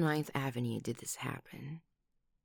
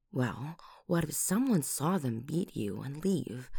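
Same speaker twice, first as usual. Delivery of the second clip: ordinary talk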